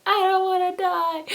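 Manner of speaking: high wailing sob of a scared pig; mock-tearful pig whimper; crying. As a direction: wail it like a crying piglet. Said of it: "crying like".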